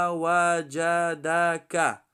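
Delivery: وجدک slowly, every syllable stretched; slow teaching read of وجدک. Shown as a demonstration. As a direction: sound out وجدک evenly and slowly. Spells word syllable by syllable.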